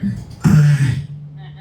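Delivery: ripping tape noise with the mouth; imitated duct tape unraveling. Imitating duct tape noise to the crowd, a rasping rip. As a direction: mimic tape tearing off the roll. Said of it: "you hear".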